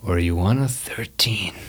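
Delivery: whispered dealer tease. Acted. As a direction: lean in and breathe it. GM teasing dealer aside.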